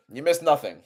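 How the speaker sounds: ordinary talk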